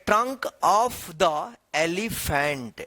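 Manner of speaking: each word drawn out slowly for learners; slow reading aloud. Shown as a demonstration. Teacher reading the chapter title slowly to students. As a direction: read it slowly so students can follow. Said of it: "यहाँ पे chapter का नाम है".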